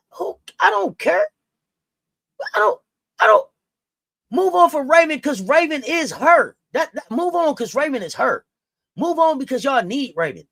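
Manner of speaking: loud, high, fed-up yelling; fed up and shouting; impassioned yell. Real, not acted. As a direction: shout it for Raven. Impassioned defense of Raven.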